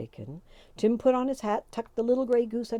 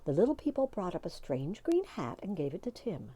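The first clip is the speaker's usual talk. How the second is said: plain reading voice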